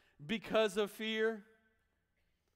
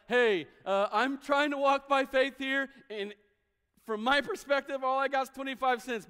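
anxious believer; 6 semitones higher, 7 dB louder than usual. Pretend. Voicing struggling listener.

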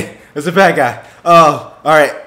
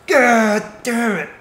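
high, slow, drawn-out curse; pained laughing curse; 6 semitones higher, slower than usual. Real, not acted. Player reeling after a shock, pained laughing curse. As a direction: groan it out through pained laughter.

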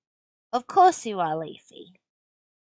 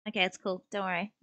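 usual speaking voice

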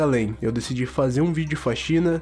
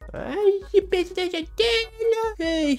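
high slow mock girly whine; squeaky parody of her voice; slower than usual. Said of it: "mocks the cleaning woman".

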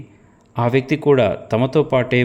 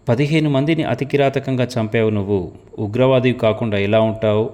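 ordinary delivery, nothing marked

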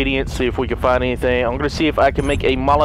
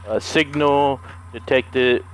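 ordinary delivery

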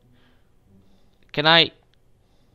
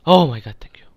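relieved shout; 11 dB louder, faster than usual. Real, not acted. Relief after a close call.

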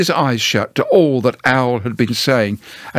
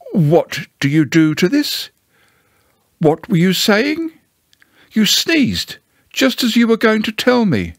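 higher-pitched innocent bear; lightly voiced Pooh, puzzled; 9 semitones higher than usual. Pooh tells Owl that he sneezed, mildly puzzled. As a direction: ask in simple puzzlement, pitched up.

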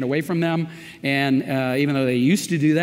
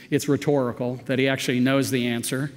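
usual speaking voice

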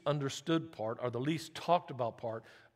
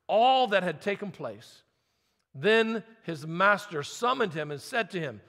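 louder, measured reading of the parable; scripture reading; 4 semitones higher, 6 dB louder than usual. Acted. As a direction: proclaim the text to the church. Pastor reads parable aloud.

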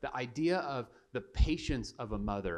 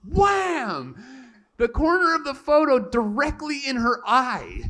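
high, loud shout of the impact; dramatic shout; 14 semitones higher, 11 dB louder than usual. Retold or imitated. Preacher telling the congregation the photo hit, shouting. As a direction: yell the hit like a crash.

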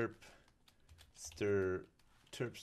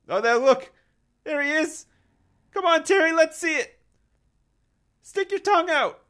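coaxing pet voice; 11 semitones higher, 7 dB louder than usual. Real, not acted. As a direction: coax the little animal sweetly.

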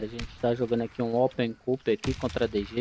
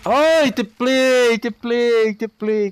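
grenade warning yell; 9 semitones higher, 7 dB louder, much slower than usual. Real, not acted. Near miss in game.

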